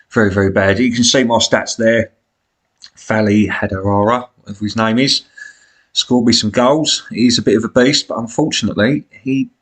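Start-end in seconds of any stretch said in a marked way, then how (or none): none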